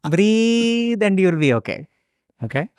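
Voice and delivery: put-on calm guru in an Indian accent. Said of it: "Indian accent".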